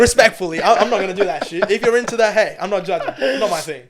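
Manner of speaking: laughing. Real, not acted. Laughing while dismissing makeup for men.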